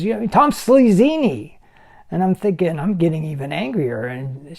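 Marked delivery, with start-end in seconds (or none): 0.0-1.5 loud, high, drawn-out mock; imitated jeering tease. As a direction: act out her taunting nickname, stretched and loud. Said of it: "she's making fun of him".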